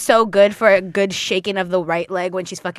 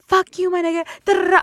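retold scream; 11 semitones higher than usual. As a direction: re-enact screaming at your brother. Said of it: "So I just screamed at him".

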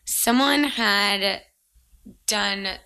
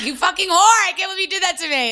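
high, loud mock outrage, thrown back as a joke; mock outraged yell; 10 semitones higher, 4 dB louder, faster than usual. Friend shrieking at Claire over the fake firing email. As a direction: squeal it like a scandalized friend.

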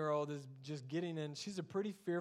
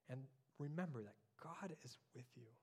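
much softer, quick and hushed; gentle pastoral hush; soft reflective reminder; 3 semitones lower, 11 dB quieter, faster than usual. Acted. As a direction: quietly remind the listener.

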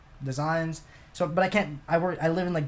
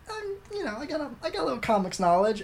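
mock modesty; 8 semitones higher than usual. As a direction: feign bashful modesty. Acts out modest show-off at movie.

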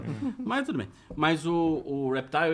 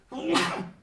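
sneezing; 12 semitones higher than usual. Real, not acted. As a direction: sneeze sharply, high burst. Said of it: "Vou espirrar".

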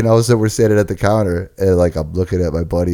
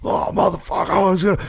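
high, strained angry dude; angry guy voice; 12 semitones higher than usual. Retold or imitated. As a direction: play the furious guy who got kicked out.

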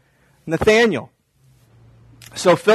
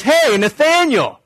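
calling out; 8 semitones higher, slower than usual. Retold or imitated. Jesus calls out to Nathanael.

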